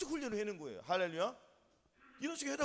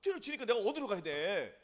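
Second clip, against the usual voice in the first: ordinary talk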